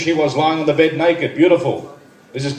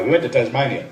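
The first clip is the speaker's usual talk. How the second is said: normal talking voice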